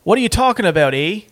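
a hammed-up Canadian accent. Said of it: "worst Canadian accents of all time".

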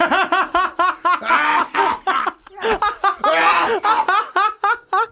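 shrieking burst of laughter; real high-pitched laugh that goes on; loud high laugh. Real, not acted. Beans taste awful, friends laughing.

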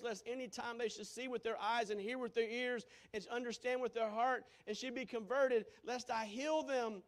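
preacher reading Jesus's words with force; preaching scripture. Acted. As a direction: proclaim it to the congregation.

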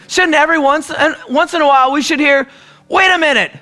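high, urgent preaching voice with a rising challenge; impassioned preaching. Acted. Pastor stirring his church, urgent high preaching. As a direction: press the congregation hard, voice lifted high.

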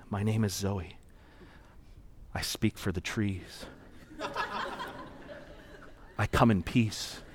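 low, slow and hushed, imitating a child; retold whisper, solemn and slow; imitated child's whispering. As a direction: softly recite it like a secret rehearsal. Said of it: "I realized it was Zoe whispering".

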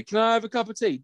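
high-pitched mimicked request; mock plea. Acting out her plea for tea.

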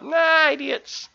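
high, drawn-out heckle; mock jeer. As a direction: imitate stream viewers jeering at a fail. Mimics stream viewers mocking a fail.

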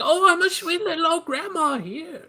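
put-on sweet old grandma. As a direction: play the sweet little old lady. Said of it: "she would be like".

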